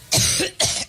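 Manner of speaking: dry hacking cough; coughing fit. Real, not acted. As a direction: cough hard into the mic. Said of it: "excuse me".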